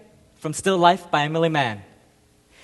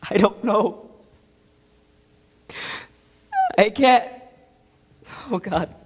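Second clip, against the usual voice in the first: halting, high-pitched distress, falling away; breaking down; 4 semitones higher, slower than usual. Acted. Soldier unable to continue confessing, halting in distress. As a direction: struggle to go on, overcome.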